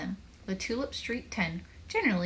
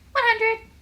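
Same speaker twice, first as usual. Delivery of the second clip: very high, loud crowing; triumphant total; 9 dB louder than usual. A narrator's reading. Joel crows the final Scrabble score, triumphant.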